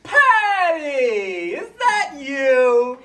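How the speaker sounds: high, loud, joyful shout of recognition; excited reunion cry. Acted. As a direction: call out thrilled to see an old friend. Surprise reunion in the line.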